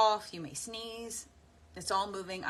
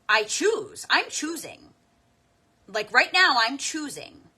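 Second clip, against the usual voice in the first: louder and higher, declaring each choice; emphatic declaration; 7 semitones higher, 13 dB louder, slower than usual. Retold or imitated. Friend Vicky's method, quoted to the group, triumphant loud declaration. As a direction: proclaim it like a triumphant vow. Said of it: "She's like".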